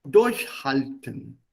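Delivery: Durchhalten drawn out slowly; slow dictionary-style "Durchhalten"; word picked out. Shown as a demonstration. Teacher isolates word to explain it.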